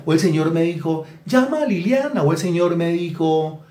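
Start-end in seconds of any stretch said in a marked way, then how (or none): 1.3-2.2 high, pious voice parroting an order from the Lord; mock divine order. Preacher mocking a flatterer who claims the Lord spoke, high pious voice. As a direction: sing out the holy instruction in a falsetto. Flatterer quoting what God told her.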